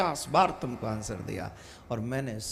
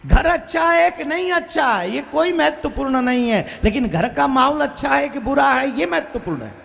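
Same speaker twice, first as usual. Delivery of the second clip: much higher, louder impassioned preaching; preaching at full force; 12 semitones higher, 8 dB louder than usual. Acted. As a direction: drive the point home to the crowd. उपदेश पूरे जोर से. (पूरे जोश में प्रवचन)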